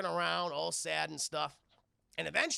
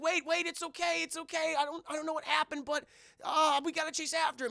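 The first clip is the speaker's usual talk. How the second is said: put-on high, urgent kid's voice; pleading boy; 10 semitones higher, 4 dB louder than usual. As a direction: imitate a boy begging them to wait. Boy pleading after robot.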